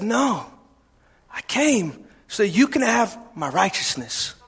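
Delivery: soft, low reassurance; gentle God's voice. Retold or imitated. Preacher voicing God to sinners, soft and tender. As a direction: speak as a tender God, softly.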